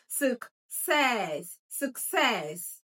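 "success" as suk-SESS, long falling second syllable; stress on second syllable. Shown as a demonstration. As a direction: draw out the stressed end of "success". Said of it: "the stress is on the second syllable".

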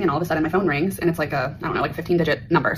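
ordinary delivery

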